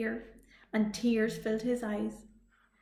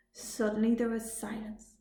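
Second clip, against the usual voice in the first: ordinary delivery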